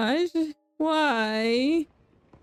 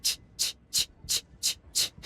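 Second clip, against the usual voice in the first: whispered ch ch ch horror-movie sound; unvoiced slasher-film whisper; slasher theme sound; 5 dB quieter than usual. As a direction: breathe the killer's theme softly. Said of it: "something similar where he's like".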